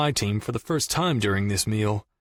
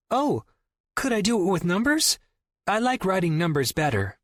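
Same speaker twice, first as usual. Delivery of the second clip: put-on higher, quick child voice; little girl voice; 8 semitones higher than usual. A narrator's reading. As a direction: play the niece, bright and quick. Niece voiced again.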